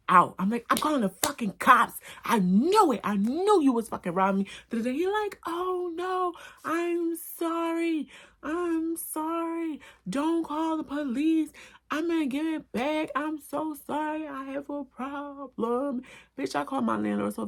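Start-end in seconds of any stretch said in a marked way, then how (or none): 0.7-4.5 loud, high furious yelling; angry threat. Retold or imitated. Threatening the thief with cops. 5.4-16.0 very high, slow pleading apology; begging crackhead. Retold or imitated. Caught thief begs forgiveness.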